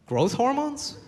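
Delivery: high, disbelieving question; can't believe what he just heard; incredulous. Retold or imitated. Disbelieving reply to his friend's health lecture.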